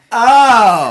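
a startled yell with a laugh in it; shocked oh. Retold or imitated. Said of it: "audibly in the front row of the audience went".

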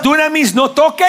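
an owner ordering his dog off the food; command to dog. Retold or imitated. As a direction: tell the dog off sharply.

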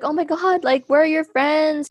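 put-on worried girl. Ambassador girl finding her on the sidewalk, high worried voice. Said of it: "and was like".

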